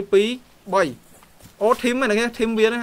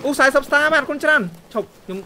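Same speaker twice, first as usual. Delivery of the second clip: higher, louder, delighted thank-you; excited thanks; 5 semitones higher, 5 dB louder than usual. Real, not acted. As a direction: burst out thanking the donor. Thanking viewer for forty stars. (អរគុណទៅអ្នកមើលដែលផ្ញើផ្កាយ ដោយរំភើប)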